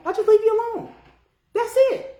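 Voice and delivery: squeaky, hands-off protest; high-pitched insistence. Real, not acted. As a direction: jump up high to say you simply walk away. Same words repeated in high voice.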